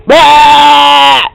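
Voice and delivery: loud frustrated "bah"; exasperated shout. Real, not acted. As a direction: blurt out a loud groan. Exasperated outburst.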